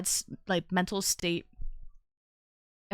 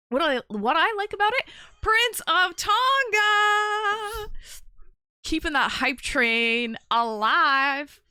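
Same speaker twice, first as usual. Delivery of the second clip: hoarse sleep deprived hype; 6 semitones higher, 6 dB louder, much slower than usual. Real, not acted. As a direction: hype shout, loud and drawn out. Hyping up a gift subscription.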